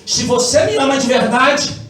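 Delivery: ordinary talk, nothing marked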